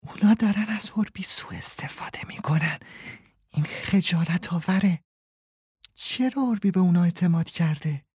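soft unvoiced whisper, a little high; hushed, so the thieves behind the glass can't hear. A narrator's reading. Linus whispering to Frederike at the window.

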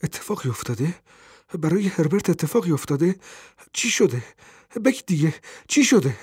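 put-on an old mother fearing the worst about her son; rising panic, pressing for an answer; breathless alarm. A narrator's reading. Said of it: "پیرزن که نفسش بند آمده بود".

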